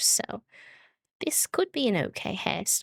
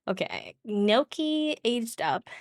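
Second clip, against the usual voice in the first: usual speaking voice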